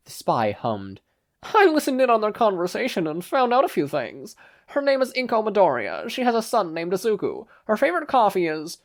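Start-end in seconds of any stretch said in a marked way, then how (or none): 1.4-8.8 put-on high, loud French-accented spy. A narrator's reading. Spy reports to his boss in a high French accent. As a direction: play the French spy, pitched up. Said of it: "the spy replied in a French accent".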